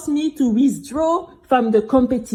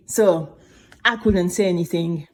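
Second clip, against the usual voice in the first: ordinary delivery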